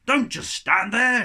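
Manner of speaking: loud, high shout; captain's shout. A narrator's reading. Captain shouting at his crew to get moving. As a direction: yell it at the crew. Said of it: "shouted Captain Jeggings".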